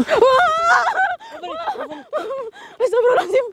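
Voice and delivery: high scream melting into a nervous laugh; startled shriek. Acted. Ghost hunter startled again by the creature, high shriek then nervous laugh. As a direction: jump out of your skin, then laugh it off.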